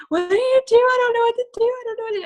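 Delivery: high, loud crying wail; wailing in tears. Retold or imitated. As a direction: sob it out, helpless and desperate. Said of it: "remember crying to her".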